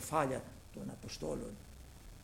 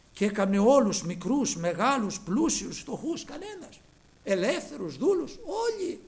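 fiery preaching; 7 semitones higher, 7 dB louder than usual. Acted. Preaching at full force.

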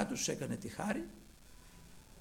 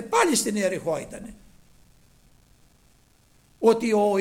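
loud, raised preacher's voice; preaching at full force; 4 semitones higher, 10 dB louder than usual. Real, not acted. Preacher telling of blind Bartimaeus to the congregation, loud preaching. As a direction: project the story to the whole congregation.

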